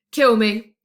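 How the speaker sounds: loud desperate howl. A narrator's reading. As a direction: howl the plea out loud, hammed up. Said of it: "Grogba howled".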